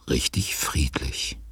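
slow, whispered calm; hushed whisper. A narrator's reading. Narrator describing the sleeping house, whispered. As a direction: whisper it as if not to wake anyone.

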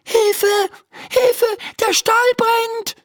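high, urgent cry for help; calling for help. A narrator's reading. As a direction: cry out the alarm high-pitched. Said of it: "ruft sie". (Hilferuf, hoch gerufen)

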